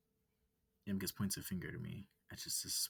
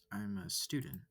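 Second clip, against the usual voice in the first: plain reading voice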